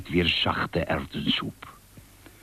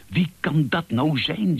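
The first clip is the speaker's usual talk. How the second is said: owl wondering aloud; 8 semitones higher than usual. Acted. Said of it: "zei Uil".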